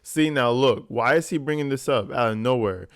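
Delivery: usual speaking voice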